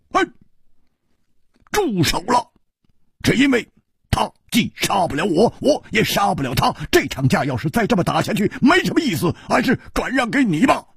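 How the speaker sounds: high, brusque swordsman; coldly. A narrator's reading. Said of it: "冷冷地说". (冷冷地高声说)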